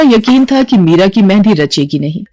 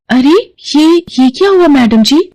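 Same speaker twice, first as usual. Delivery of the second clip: higher-pitched, startled concern; acted alarmed surprise; 7 semitones higher than usual. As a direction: stammer slightly in shock, voice lifting. Maid sees madam's blistered hands. (घबराई हुई हैरानी)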